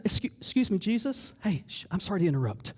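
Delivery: soft, hesitant mock apology; timid, polite interruption. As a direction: interrupt meekly, keeping your voice down. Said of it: "no, she's like".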